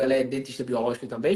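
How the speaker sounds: ordinary talk, nothing marked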